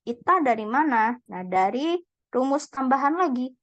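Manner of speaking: normal talking voice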